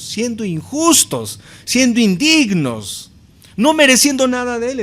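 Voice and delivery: building from emphatic to a high, loud cry; rising preaching. Acted. Predicación que sube de fuerza. (predicación que crece hasta un clamor alto y fuerte)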